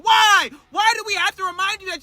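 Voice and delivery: loud high shouted why; outraged shout. Real, not acted. Fan reacting to the seven-foot reminder, shouted outrage. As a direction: yell it in exasperation.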